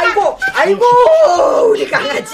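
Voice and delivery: high, loud cries of joy over a grandchild; a grandma's tearful, happy wail; overjoyed wailing greeting. Acted. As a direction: cry out in delight, arms wide. Grandma greets grandchild after long time.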